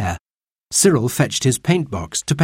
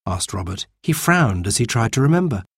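ordinary delivery

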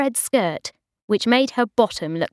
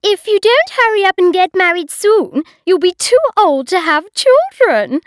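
loud, much higher nagging voice; bossy hostess; 11 semitones higher, 7 dB louder than usual. A narrator's reading. Hostess nagging Bridget about marriage, high and loud. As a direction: lecture her like a bossy aunt. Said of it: "said Una, his wife".

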